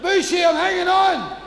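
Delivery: high shouted explanation; frantic shout. Acted. Stranded man shouting up to God, frantic. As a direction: yell up pleading your case.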